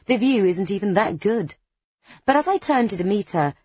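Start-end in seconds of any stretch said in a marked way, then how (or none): none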